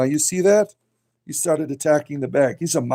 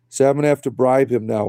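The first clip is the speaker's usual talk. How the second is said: usual speaking voice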